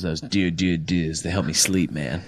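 mock a sportscaster's accent, voice dropped down; Chris Berman's stupid accent. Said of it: "say it in his stupid accent".

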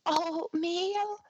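small, high, breathy and sad; sad breathy kid voice. Retold or imitated. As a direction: sigh it out like a tiny sad child. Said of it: "this little sad, little, you know, breathy voice".

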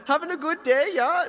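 high, singsong mock German accent; chirpy German waiter impression; German Willy Wonka. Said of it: "like, a German Willy Wonka".

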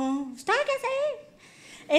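very high, drawn-out squeal; mock indignant king, stretched high; mimicked scolding. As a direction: squeeze out a long, high-pitched imitation. Mimics the king's feared rebuke.

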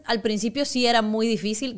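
normal talking voice

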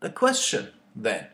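performed poem with mounting ire; poem reading. Acted. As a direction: read it like a rising protest, building indignation. Reads his own poem aloud.

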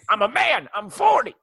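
high-pitched mock coach rant; Mike Gundy impression. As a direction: ham up an indignant football coach yelling. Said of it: "Trying to do my best Mike Gundy impression".

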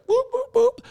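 squeaky high whoop-whoop, like a cartoon alarm; tea-spilling sound effect. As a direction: chirp it way up high as you sneak over for gossip. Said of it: "like that Instagram my therapist says".

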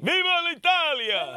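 bright high rallying cry; festive cheer. Real, not acted. Radio host cheering on the Italian festival, high and excited. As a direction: shout it like a toast.

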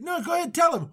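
high, hurried nudge; mock eager prodding. Husband prods wife to back his story, mock eagerness. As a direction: coax her with put-on cheer.

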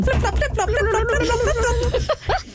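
footstep noises. Acted. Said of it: "[name], footsteps running".

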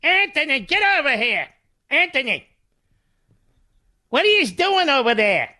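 South Philly neighbor shouting for Anthony; South Philly calling out. Retold or imitated. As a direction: call him in from across the block, South Philly style. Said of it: "the way they say it in South Philly".

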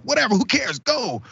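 high, brushing-off mock tone; 高声不屑; dismissive.

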